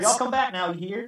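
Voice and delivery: high, hammed-up Southern farewell; playful mock Southern accent. Shown as a demonstration. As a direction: put on a folksy Southern accent. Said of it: "Southern real quick".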